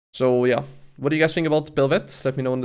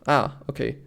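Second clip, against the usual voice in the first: ordinary talk, nothing marked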